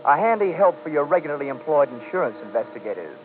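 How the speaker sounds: dry, matter-of-fact report read; expense report narration. A narrator's reading. Detective reading his expense account to the home office. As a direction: list items like an official memo.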